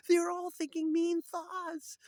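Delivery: very high, loud, drawn-out mock wail; exaggerated cry of dismay; hammed-up anguish. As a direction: wail it slowly in comic horror. Retelling mind reader's distress.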